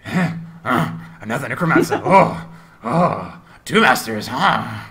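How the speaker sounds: grunting creature voice with punctuating "oh"s; disembodied head. Acted. Severed head notices another necromancer, grunting creature voice. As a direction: break the words up with odd loud grunts. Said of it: "its eyes look up and goes".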